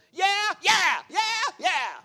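high, loud, excited deputy yelps; Barney Fife. Retold or imitated. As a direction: yelp it like a jumpy small-town deputy. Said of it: "almost like Barney Fife and Otis".

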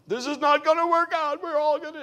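high, loud, frantic wail; panicky drunk. Retold or imitated. Drunk man on the plane panicking to everyone, high frantic wail. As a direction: play a scared drunk losing it.

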